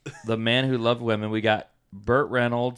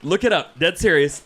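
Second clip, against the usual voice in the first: high, loud laughing insistence; insistent; 7 semitones higher, 6 dB louder than usual. Real, not acted. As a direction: insist it's real, laughing. Insisting it's real.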